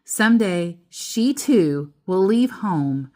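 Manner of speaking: ordinary delivery, nothing marked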